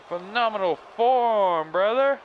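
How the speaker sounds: high, stretched-out wrestler hype; hyped wrestler praise. Pretend. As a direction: crow over the move, drawing it out. Cheering own move in the game.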